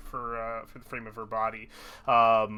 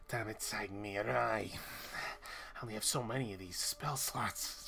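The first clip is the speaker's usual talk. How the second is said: low, soft irritated mumble; mumbled under breath; 5 semitones lower, 10 dB quieter than usual. Acted. Mage grumbling as the party exits, muttered under her breath. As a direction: grumble privately, much quieter and lower. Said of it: "she sort of mumbles under her breath".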